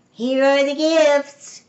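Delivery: slow, high, loud announcement; calling out. A narrator's reading. Amelia Bedelia dumps gifts on Miss Alma, calling out. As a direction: announce it loudly, stretching the words.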